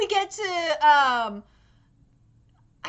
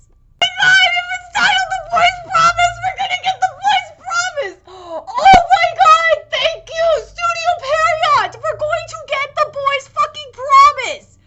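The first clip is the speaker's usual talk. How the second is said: high, loud, fast burst of fan excitement; thrilled shouting, pitched way up; ecstatic outburst; 8 semitones higher, 7 dB louder than usual. Real, not acted. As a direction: shout it out, overjoyed at the news. Reacting ecstatically to the episode title.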